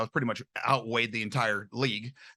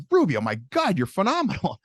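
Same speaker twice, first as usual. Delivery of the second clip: amazed praise; 6 semitones higher, 4 dB louder than usual. Retold or imitated. Buddy amazed at his snap.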